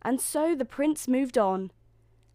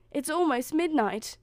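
higher-pitched, mild alarm; crying out; 6 semitones higher, 3 dB louder than usual. A narrator's reading. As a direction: realise the time with a start. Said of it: "she cried out".

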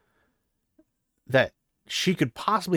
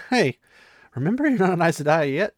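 higher, louder stern correction with a hint of a smile; firm but amused rebuke; steel in her voice; 5 semitones higher, 6 dB louder than usual. Sheriam reminding Egwene she is not Aes Sedai, stern but amused. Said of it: "despite there being steel in her voice".

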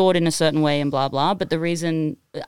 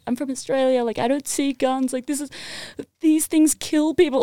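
retold tearful explaining; 8 semitones higher than usual. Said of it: "why I was crying".

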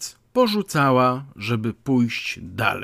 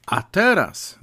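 higher-pitched indignant exclamation; raised voice; 6 semitones higher, 4 dB louder than usual. Acted. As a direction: call out with rising outrage. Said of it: "gestykulując i podnosząc głos". (podniesiony, oburzony głos)

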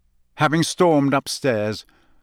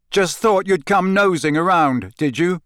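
loud, higher, accusing; angry shout; 7 semitones higher, 4 dB louder than usual. A narrator's reading. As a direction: yell it at him. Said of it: "Harry shouted".

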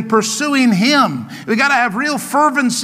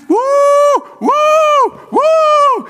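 loud, high "woo", over and over; jubilant whoops; 5 dB louder, much slower than usual. Acted. Preacher acting out happy David dancing, high loud whoops. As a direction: whoop like a man dancing for joy.

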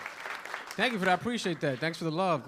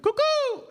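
very high, loud "cuckoo" hoot; street call; 6 dB louder than usual. Retold or imitated. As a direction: hoot it like a bird call across the block. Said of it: "they're like".

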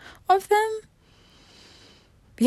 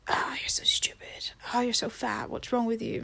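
whispered mock self-criticism, softer; mock self-insults; 7 dB quieter than usual. Imitating a bullying inner voice in a soft whisper. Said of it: "I don't sit there and be like".

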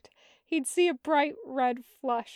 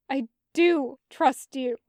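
louder, sharp and defensive; 9 dB louder than usual. Acted. Zhang Cheng answers his brother sharply. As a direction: snap it back sharply. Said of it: "sharp as the bursting sweetness".

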